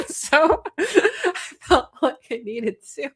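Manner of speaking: talking through laughter; laughing. Real, not acted. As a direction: giggle through the words. Laughing at herself.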